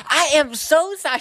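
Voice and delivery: high, slow southern twang. Retold or imitated. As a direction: gush it in a southern waitress drawl. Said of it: "She got a southern twang".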